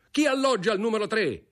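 high, urgent called-out question; shouted demand. A narrator's reading. Brigadier asking who lodges in the ringing room, shouting. Brigadier calling out amid alarm bell.

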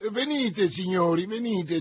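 high, loud summons; said loudly. A narrator's reading. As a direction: call the guards forward for all to hear. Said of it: "disse forte D'Artagnan". (invito a voce alta)

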